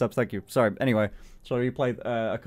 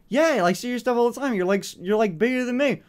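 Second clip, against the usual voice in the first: high, light imitation of a friendly fan; casual fan; 10 semitones higher, 3 dB louder than usual. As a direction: lightly play him shrugging off the compliment. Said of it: "He went, like".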